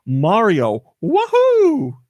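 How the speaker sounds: whooping Mario catchphrase, leaping high on the wahoo; high, gleeful "wahoo" in imitation; Mario impression. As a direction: mimic a cheering video game hero. Imitating costumed host's Mario catchphrase.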